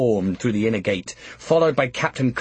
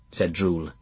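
ordinary delivery, nothing marked

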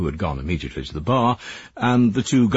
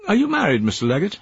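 lightly voiced woman; 12 semitones higher, faster than usual. Joan's voice.

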